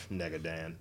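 very low, hushed evil twin voice; deep villain voice. Retold or imitated. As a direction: growl it low like a comic villain. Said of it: "who's your arch villain".

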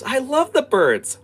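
very high, loud, slow squeal of delight; lit-up little girl. Acted. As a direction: gush like a thrilled child. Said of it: "she just lights up and goes".

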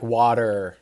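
WATER in an American accent with a strong final R. Shown as a demonstration. American explaining strongly pronounced R's, American accent on WATER. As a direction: say "water" the American way, R sounded hard. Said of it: "That's how we would say water".

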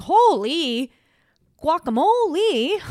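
loud, high, stretched amazement; amazed exclamation. Retold or imitated. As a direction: shout it in delighted shock. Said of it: "I was like".

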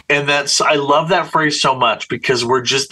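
normal talking voice

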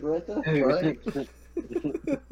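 high, rising, laughing what the fuck; baffled, laughing it out; shocked at the random scene; stunned disbelief. Retold or imitated. Stunned reaction in retold story.

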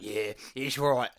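slow, low, grudging yeah; imitated unhappy agreement. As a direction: agree reluctantly, clearly not happy. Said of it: "But he wasn't happy".

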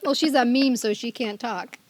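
ordinary delivery

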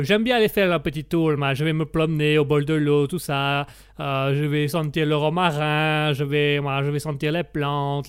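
leisurely mock Italian accent. Italian guest describes his gentle day, contented Italian accent. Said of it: "comme on l'entend à mon accent".

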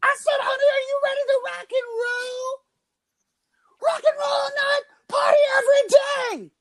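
[name] rock-star voice. Acted. Podcast host playing [name] for the crowd, hammed-up arena shout. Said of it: "that was the voice of [name]".

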